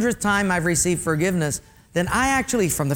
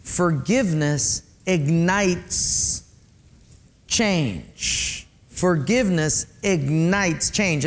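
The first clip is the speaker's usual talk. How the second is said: slow emphatic statement; much slower than usual. Shown as a demonstration. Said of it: "would you define it in this way".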